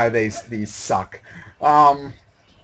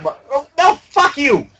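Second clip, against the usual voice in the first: angry outburst; 10 semitones higher, 4 dB louder than usual. Real, not acted. Game frustration outburst.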